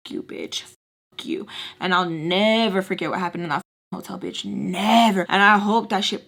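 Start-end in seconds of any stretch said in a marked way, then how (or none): 0.1-1.5 whispering. Retold or imitated. Cursing at her, whispered.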